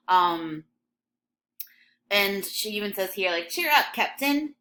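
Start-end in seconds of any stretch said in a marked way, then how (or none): none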